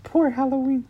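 tiny pitiful voice, teasing; mock heartfelt sincerity. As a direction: mimic a friend's pained sincerity, hammed up. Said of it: "I could hear the sincerity in your voice".

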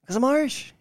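high-pitched, badly faked Irish accent. Friend claiming to be Irish, bad put-on Irish accent. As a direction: overdo a poor Irish brogue, high. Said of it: "That was bad".

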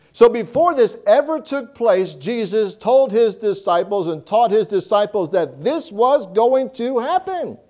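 ordinary talk

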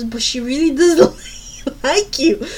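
imitating a brother laughing mid-sentence; cracking up. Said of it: "he's just cracking up".

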